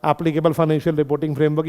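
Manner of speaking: rushed off as a passing mention; fast throwaway of the phrase; quick, unstressed. Shown as a demonstration. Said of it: "इस word पे जोर नहीं दूंगा". (जल्दी से, बिना ज़ोर दिए)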